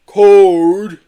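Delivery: loud, high, drawn-out amusement; amused chuckle. A narrator's reading. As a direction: scoff merrily at the idea. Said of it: "chuckled Marbo".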